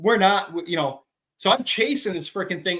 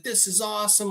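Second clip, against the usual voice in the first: higher, hushed mock amazement; awed visitor; 5 semitones higher, 4 dB quieter than usual. California buddy seeing the farm, awed. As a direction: imitate a mind-blown visitor. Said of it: "he was freaking out".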